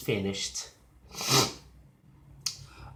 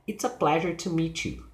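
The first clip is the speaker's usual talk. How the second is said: finished-sounding statement, pitch down on "meet you"; falling intonation; 3 semitones higher than usual. Shown as a demonstration. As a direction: let the pitch fall on "you". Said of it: "the intonation is falling".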